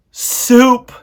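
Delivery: high, loud wail of a word; loud moan. A narrator's reading. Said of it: "moaned Roscuro".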